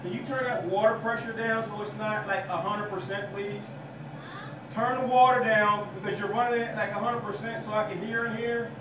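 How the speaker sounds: high, strained call to another room, pitch well up; calling out through the door. Real, not acted. Calling to daughter in the shower.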